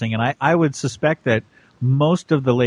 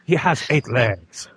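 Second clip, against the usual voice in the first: Jacques Cousteau impression. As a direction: do a French accent, documentary style. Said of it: "Or Jacques Cousteau".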